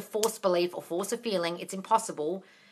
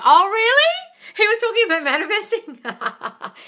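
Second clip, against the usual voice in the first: much higher, slow, drawn-out gasp of glee; delighted surprise; 12 semitones higher, 7 dB louder, slower than usual. Real, not acted. Thrilled surprise at a viewer's crush news. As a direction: let the surprise soar.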